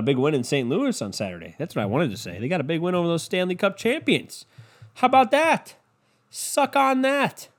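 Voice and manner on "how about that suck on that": gloating taunt. Real, not acted. Gloating over Leafs win.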